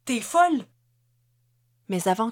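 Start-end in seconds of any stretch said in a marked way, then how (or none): 0.1-0.7 high, loud protest; alarmed cry. A narrator's reading. Hans protests Ruby's reckless move, crying out. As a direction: cry out in disbelief, pitched up. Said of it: "s'écria Hans".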